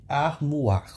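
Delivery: "Armoire" with oi said as wa; French pronunciation. Shown as a demonstration. Teacher asking students to pronounce it, French pronunciation. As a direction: say "Armoire" as ar-MWAR.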